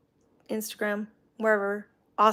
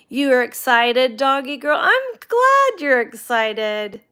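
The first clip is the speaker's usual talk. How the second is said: excited; 3 semitones higher, 9 dB louder than usual. Real, not acted. Gushing over her excited dog.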